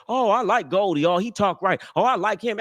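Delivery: high-pitched imitation of women fans; mocking high voice of female admirers; mock online admirers. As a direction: mimic gushing fans up high. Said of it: "bitches out there online saying".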